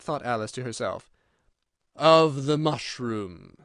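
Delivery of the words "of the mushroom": very slow, louder creature voice; languid caterpillar. A narrator's reading. Caterpillar answers Alice's unspoken question, slow and drawn-out. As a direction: draw it right out as the caterpillar. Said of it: "said the caterpillar".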